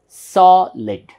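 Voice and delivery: SOLID as a loud long SAW, then lid; syllable by syllable. Shown as a demonstration. Teacher demonstrates two syllables, syllable by syllable. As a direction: punch and stretch the first half of "Solid". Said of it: "Two times".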